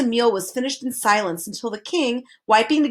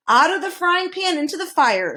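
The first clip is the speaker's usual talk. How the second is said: shouted; 8 semitones higher than usual. A narrator's reading. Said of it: "shouted the count".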